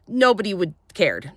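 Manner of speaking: ordinary talk, nothing marked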